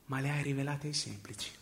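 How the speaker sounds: normal talking voice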